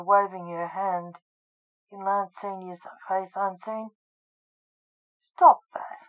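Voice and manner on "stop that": sharp, high, loud snap; snapped. A narrator's reading. Junior tells someone to stop, snapped. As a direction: snap it out louder and higher. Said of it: "snapped Lance Junior".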